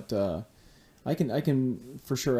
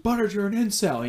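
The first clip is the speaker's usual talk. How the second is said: mock yell at dog; 10 semitones higher than usual. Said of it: "He just screams at him".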